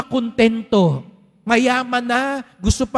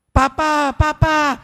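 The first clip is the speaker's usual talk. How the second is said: put-on higher child's voice calling Papa; little girl calling; 4 semitones higher, slower than usual. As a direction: run in calling for father. Anak na babae tumatakbo. (batang babae na tumatawag sa ama, mataas ang boses)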